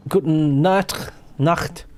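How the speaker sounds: drawn-out "guten Nacht" with a German "ch"; German goodnight. Real, not acted. Greeting the viewer Fire from Australia, slow playful German. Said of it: "I don't know why I said it in German".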